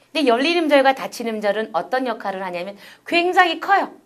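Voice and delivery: ordinary delivery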